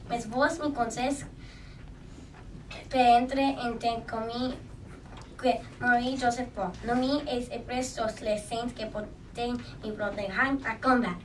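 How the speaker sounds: put-on French-accented French speech; speaking French. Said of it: "Pardon my French".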